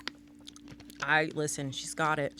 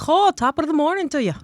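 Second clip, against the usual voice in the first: a mock Irish brogue, louder and higher; 8 semitones higher, 6 dB louder than usual. Friend teasing an Irish boxer, in a put-on Irish accent. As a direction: ham up a fake Irish greeting. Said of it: "get all extra Irish on me".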